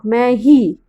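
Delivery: ordinary talk, nothing marked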